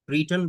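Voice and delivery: क्रीटन said Kree-tun, long ee in the first syllable; क्रीटन with a long ee, not क्रेटन or क्रेटॉन; say Kreeton. Shown as a demonstration. Teacher showing the class how to say क्रीटन. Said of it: "इसको क्रेटन या क्रेटॉन नहीं पढ़ेंगे".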